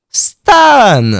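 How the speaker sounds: loud high call; shouting a name. Real, not acted. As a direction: shout after him. Calling after the salesman.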